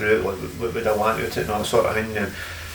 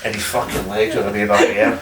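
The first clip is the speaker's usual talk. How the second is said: normal talking voice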